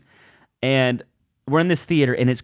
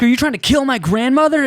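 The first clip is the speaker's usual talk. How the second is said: outraged scold; 10 semitones higher, 4 dB louder than usual. Retold or imitated. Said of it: "now everybody's like".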